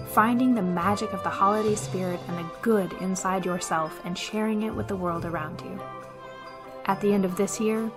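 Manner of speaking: ordinary talk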